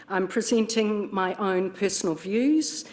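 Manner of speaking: usual speaking voice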